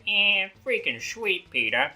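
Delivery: high drawn-out cartoon dad catchphrase; Peter Griffin. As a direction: draw it out, high, as a cartoon impression. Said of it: "your Stewie voice and your Peter voice".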